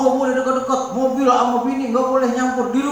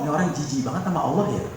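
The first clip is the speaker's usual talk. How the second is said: mock disgusted aside; 8 semitones lower, 7 dB quieter, faster than usual. Quoting imagined reaction.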